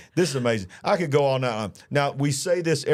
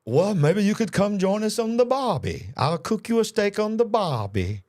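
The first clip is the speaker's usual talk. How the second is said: higher-pitched, hammed-up Australian accent; mock Australian accent; 7 semitones higher than usual. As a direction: put on a playful bad Australian accent. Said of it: "trying to talk like an Australian".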